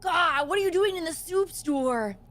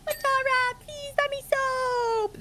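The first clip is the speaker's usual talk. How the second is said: much higher, slow put-on plea; imitating Matara; 12 semitones higher than usual. Playing the friend begging for soap, high and slow. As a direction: whine it up high like her. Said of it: "How did I sound like her?".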